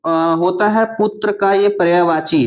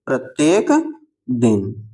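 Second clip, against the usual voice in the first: प्रत्येक, a gap, then दिन; प्रत्येक दिन drawn out for note-taking; slow dictation; 4 semitones lower, slower than usual. Shown as a demonstration. Repeats answer slowly for learners.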